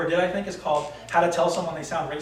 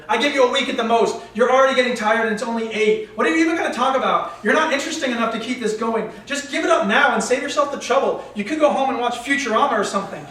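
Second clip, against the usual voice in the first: higher-pitched chastising voice in the head; heckling inner critic; 6 semitones higher, 5 dB louder than usual. Acted. Little hater chastising the guy on a date, heckling. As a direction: needle him as his own inner hater. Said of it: "The little hater tried to intervene".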